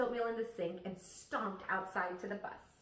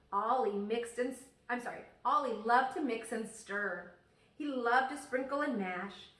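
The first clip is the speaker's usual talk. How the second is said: plain reading voice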